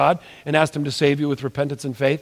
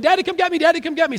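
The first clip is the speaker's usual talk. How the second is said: put-on kids calling for dad; 13 semitones higher, faster than usual. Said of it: "they said".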